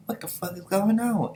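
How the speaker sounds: high, baffled cry; can't believe they're still standing; shocked outburst. Acted. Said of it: "Kokuo was like".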